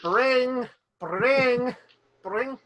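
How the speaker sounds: mock phone ring, bring bring; phone ring imitation. GM ringing the character's phone, mock ring. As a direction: ring it out like a telephone.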